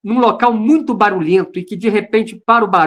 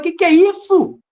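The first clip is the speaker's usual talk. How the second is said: high-pitched retold bewilderment; baffled exclamation; 7 semitones higher than usual. Man retelling confusion at tears he cannot stop, baffled exclamation. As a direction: exclaim it as if mystified.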